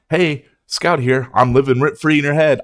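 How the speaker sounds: mock intro. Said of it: "start saying that in every video".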